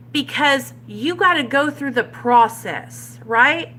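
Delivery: usual speaking voice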